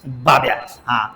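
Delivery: ordinary talk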